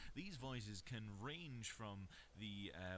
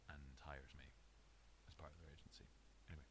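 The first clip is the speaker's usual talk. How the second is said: whisper; 6 semitones lower, 9 dB quieter, faster than usual. Real, not acted. Aside about wanting to be hired.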